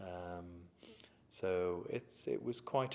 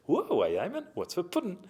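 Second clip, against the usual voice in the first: put-on higher, keen little boy asking about pudding; imitating his young self at boarding school, higher and louder; eager schoolboy; 10 semitones higher, 6 dB louder than usual. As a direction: play the new boy, keen for dessert.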